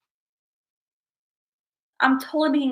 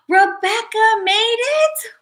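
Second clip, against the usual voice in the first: excited gasp; 8 semitones higher, 5 dB louder, much slower than usual. Real, not acted. Friend returns to the stream.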